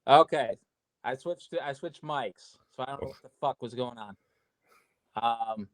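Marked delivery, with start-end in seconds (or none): 1.0-4.1 hollow, distant mic sound; like talking from inside a tunnel; echoing tunnel. Real, not acted. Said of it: "you sound like you're in a tunnel now".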